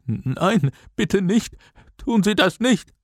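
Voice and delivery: high-pitched pleading whimper. A narrator's reading. Teacher dragged toward the roof edge, whimpering. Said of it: "Die Brillenschlange winselte".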